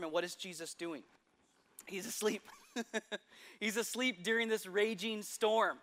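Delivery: ordinary talk, nothing marked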